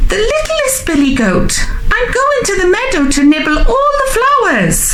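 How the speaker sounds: very high, quick squeaky voice; high-pitched, hurried little goat; squealing baby goat. A narrator's reading. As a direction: chirp it like a tiny goat. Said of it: "Baby Gruff squealed".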